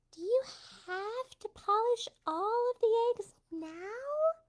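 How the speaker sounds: high, slow squeaky kitty voice; high cat voice asking. Acted. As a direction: play the impatient little cat. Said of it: "said the cat".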